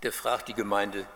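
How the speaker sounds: ordinary talk, nothing marked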